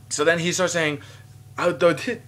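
ordinary talk, nothing marked

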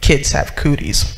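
put-on sulky husband brushing off the kids; grumpy old man. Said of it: "he was known to be a grumpy man".

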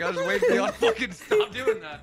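laughing. Real, not acted. Caught cracking up.

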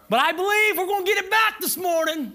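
rallying shout. Real, not acted. Preacher rallies the congregation.

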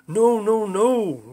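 loud, high desperate cry of no. A narrator's reading. Lionel Jeffries watching the mountain land, crying out loudly. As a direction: cry out in horror as the mountain lands. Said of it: "Lionel Jeffries cried".

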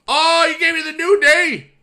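high, loud cry of delight; real, high-pitched outburst of joy; excited celebration. Real, not acted. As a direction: yell it in thrilled disbelief. Celebrating a lucky draw in game.

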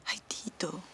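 soft unvoiced whisper of his name; tender whisper. (susurro tierno)

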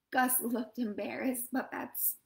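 plain reading voice

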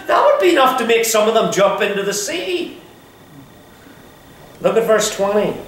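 normal talking voice

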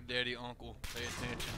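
normal talking voice

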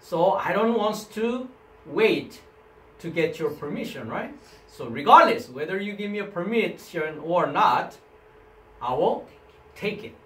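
usual speaking voice

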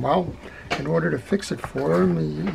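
ordinary delivery

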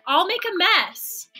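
ordinary delivery, nothing marked

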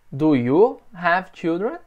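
slow, clear question to the student; teacher modelling slowly; slow model question. Shown as a demonstration. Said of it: "Pergunta pra mim".

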